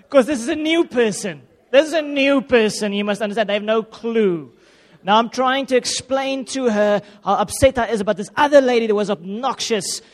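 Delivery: high, loud and worked up; reliving the panic of the call; flustered, voice climbing; agitated. Real, not acted.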